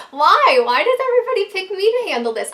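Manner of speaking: loud, high, fast complaining yell; exasperated protest. Retold or imitated. Narrator shouting at the husband in protest, exasperated. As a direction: throw up your hands and protest loudly. Arguing with husband over who goes.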